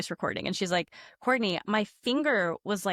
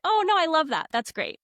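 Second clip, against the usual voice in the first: delighted reply; 9 semitones higher, 5 dB louder than usual. Retold or imitated. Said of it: "And she was like".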